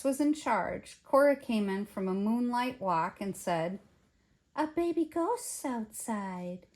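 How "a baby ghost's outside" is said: slow, slightly raised cat voice; voiced cat character. A narrator's reading. Cat back from a moonlight walk, slow announcing voice. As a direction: draw out the cat's news, slowly.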